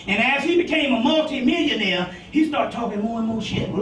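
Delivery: usual speaking voice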